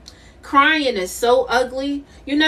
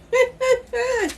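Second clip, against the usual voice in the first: high laugh; 11 semitones higher than usual. Real, not acted. Laughing at her own line.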